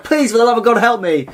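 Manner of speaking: mock desperate begging; pleading. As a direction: beg for rescue from the gut. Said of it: "You're gonna be like".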